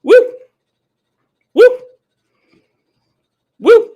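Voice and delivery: high loud whoop; excited whoop. Real, not acted. Cheering repeatedly.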